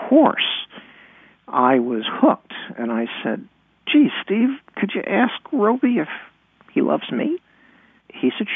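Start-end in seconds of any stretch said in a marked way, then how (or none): 3.9-7.4 put-on hopeful child. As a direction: ask big brother hopefully, voice pitched up. Child self begs a favor, retold.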